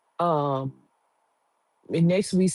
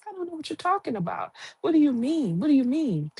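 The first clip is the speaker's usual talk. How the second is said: clueless Tiff; 9 semitones higher, faster than usual. Retold or imitated.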